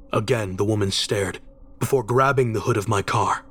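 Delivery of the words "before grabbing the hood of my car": plain reading voice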